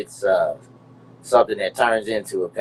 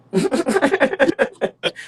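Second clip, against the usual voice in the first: loud high laugh; 6 dB louder than usual. Real, not acted. Big laugh after "dope.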